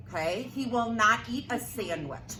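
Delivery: plain reading voice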